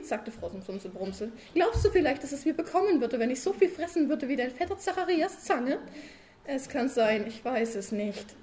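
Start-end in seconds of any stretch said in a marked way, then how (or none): 1.5-5.8 high, loud wife pestering her sleepy husband; nagging wife. Acted. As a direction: keep needling him with a raised voice. Frau Sumsum als Figur gesprochen. (hohe, laute nörgelnde Ehefrau)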